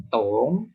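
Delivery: "Tong" sinking low and swinging back up; third tone. Shown as a demonstration. Teacher repeating an example for students, "Tong" in dipping third tone. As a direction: say "Tong" as a dip-then-rise tone. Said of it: "nada tiga".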